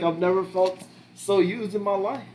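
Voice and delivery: hammed-up Filipino accent imitating an older coworker; terrible Filipino accent. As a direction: put on a bad Filipino accent for the old coworker. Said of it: "Terrible Filipino accent".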